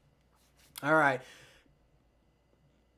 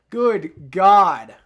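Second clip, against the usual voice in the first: loud exasperated exclamation; 10 dB louder than usual. Real, not acted. Reacts to awful passing stats.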